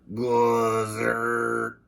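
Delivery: ray-gun zap word, drawn out; zapping sound effect. Acted.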